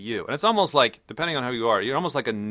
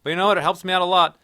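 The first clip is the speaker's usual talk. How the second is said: higher, louder breezy comeback; cheerful defiant reply; 6 semitones higher, 5 dB louder than usual. Pretend. Answering nagging scientists, bright cheerful defiance. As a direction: shrug it off brightly.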